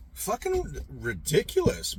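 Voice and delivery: usual speaking voice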